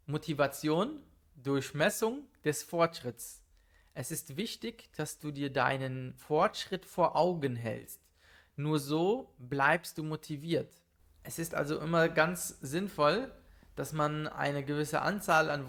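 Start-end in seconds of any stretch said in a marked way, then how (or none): none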